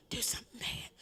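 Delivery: unvoiced creepy whisper; whispered first take. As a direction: hush it like a voice in his head. Son's whispered voices.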